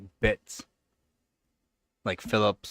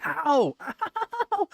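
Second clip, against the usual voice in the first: mock ow. Real, not acted. Playful cat clawing.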